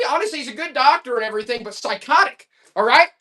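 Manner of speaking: usual speaking voice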